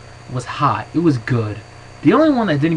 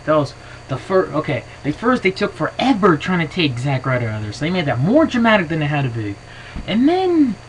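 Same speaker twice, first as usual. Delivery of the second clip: usual speaking voice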